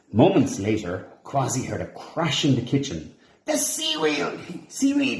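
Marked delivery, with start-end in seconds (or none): 3.5-5.2 high panicked yell. A narrator's reading. Quasi finding the kitchen seaweed gone, high panicked yell. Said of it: "he yelled".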